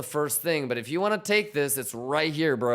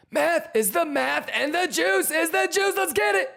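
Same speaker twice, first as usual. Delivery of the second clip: very high shouted clown chant; silly clown; 12 semitones higher than usual. Acted. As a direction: hype the chat like a cartoon clown.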